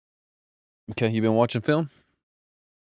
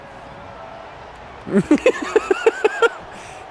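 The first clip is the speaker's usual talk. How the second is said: burst of laughter. Real, not acted. Laughing at the button mix-up.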